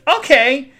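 high loud rising okay; chirpy okay. Retold or imitated. Waitress replying to the customer, high rising okay. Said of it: "And she goes".

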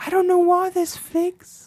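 high, put-on English lady accent; subdued English lady. Chatting in the ladies' club, high mock English accent. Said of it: "subdued English lady".

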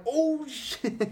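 high laughing "oh shit"; laughing alarm. Retold or imitated. Retelling poking a classmate's eye, laughing alarm. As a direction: gasp it out high through a laugh.

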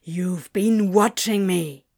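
accusing. A narrator's reading. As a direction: draw out the accusation slowly. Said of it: "said accusingly".

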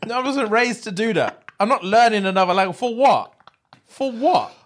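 high-pitched, hammed-up indignation; mock ignorant refusal. Playing an ignorant English speaker refusing another language, mock-affronted. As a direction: scoff at the very idea, playing stubborn. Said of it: "I'm so ignorant".

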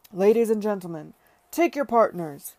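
very loud master-of-ceremonies call; crying out. A narrator's reading. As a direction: call the whole ballroom to dance. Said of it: "he cried".

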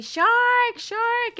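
high shrill warning cry; mock panicked scream; retelling the beach scream again; screaming shark. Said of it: "you started screaming".

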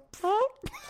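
high, silly noise under the mask; imitated mask noise. Retelling Kane Hodder's mask noises, high silly squeal. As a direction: squeal like a goofy killer behind the mask. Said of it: "I'd just go".

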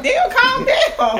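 laughing retort. Retold or imitated. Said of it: "I was like".

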